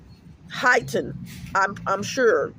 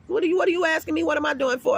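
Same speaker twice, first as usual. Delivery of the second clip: retold indignant retort; 7 semitones higher, much faster than usual. Retold retort to prying woman.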